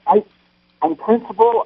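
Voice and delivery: normal talking voice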